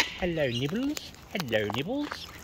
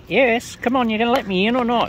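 higher, louder call to the birds; playful calling out; 7 semitones higher, 7 dB louder than usual. Real, not acted. Calling pushy birds aside.

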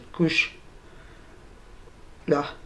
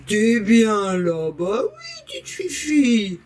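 pet talk; much slower than usual. Real, not acted. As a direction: coo at the cat, jumping way up on oui. Roucoule à la chatte. (voix de bébé, oui très aigu)

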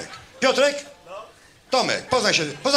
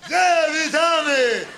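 mock crowd reply; 7 semitones higher, much slower than usual. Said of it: "jak taka ciemna masa".

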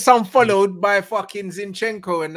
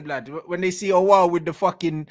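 normal talking voice

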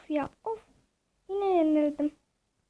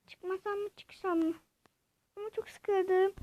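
ordinary talk

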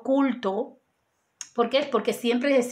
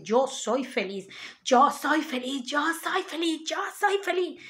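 cada repetición más aguda y más suave; repítelo como un mantra que sube y se apaga; afirmación repetida; 2 semitones higher, 4 dB quieter than usual. Shown as a demonstration. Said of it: "lo repito veintiún veces". (mantra de afirmación que sube de tono y baja de volumen)

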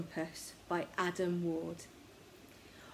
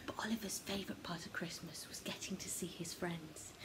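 soft whisper; 9 dB quieter than usual. A narrator's reading. Storyteller whispers.

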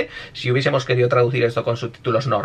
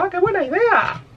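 high-pitched mock admiration; imitación de personaje. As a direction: squeal it like an anime sidekick. Acts out the character's line. (voz aguda imitando al personaje)

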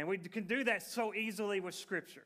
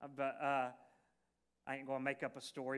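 ordinary talk, nothing marked

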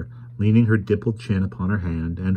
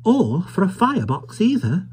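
high, musing little voice; contemplative toymaker; 13 semitones higher than usual. A narrator's reading. Caleb considering the stranger as a toy, high musing. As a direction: ponder aloud in a high voice. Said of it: "observed Caleb in deep contemplation".

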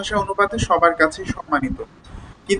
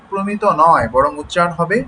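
usual speaking voice

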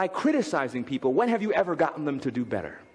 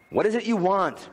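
louder, higher impatient snap; snapped; 5 semitones higher, 4 dB louder than usual. Acted. Father interrupted by his son, snapped. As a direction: snap it at the child in the doorway. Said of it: "I snapped".

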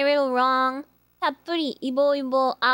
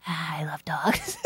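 breathy little whisper, slowed down; 9 semitones lower, 5 dB quieter, slower than usual. Real, not acted. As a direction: whisper it secretly, almost to yourself. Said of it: "I don't know why I said it like that".

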